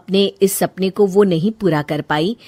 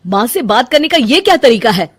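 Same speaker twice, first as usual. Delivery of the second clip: louder, stern question; reproach; 3 semitones higher, 6 dB louder than usual. Acted. Husband reproaching wife for rudeness to his mother, louder. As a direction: demand an explanation.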